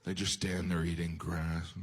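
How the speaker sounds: usual speaking voice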